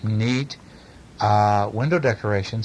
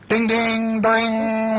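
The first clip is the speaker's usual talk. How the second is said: alarm bell ding; 11 semitones higher, much slower than usual. A narrator's reading. Reading the alarm bell aloud.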